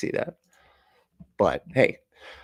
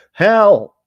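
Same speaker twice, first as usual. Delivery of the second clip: high, loud yelling at a phone; acted frustrated trucker yelling; exasperated shouting; 10 semitones higher, 11 dB louder, much slower than usual. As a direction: yell the word at dumb voice dictation. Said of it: "He's driving a truck, probably, so he's like".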